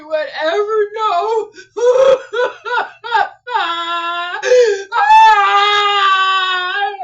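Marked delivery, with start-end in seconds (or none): 1.6-4.9 a loud sobbing laugh bursting out; tearful laugh-sob. Real, not acted. Creator breaking down over her Google ranking, sobbing laugh. Overjoyed, thanking God. 5.0-6.9 a loud sobbing gasp of relief; tearful cry of joy. Real, not acted. Creator thanking God for her success, sobbing ah.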